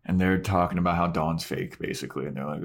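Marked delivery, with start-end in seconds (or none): none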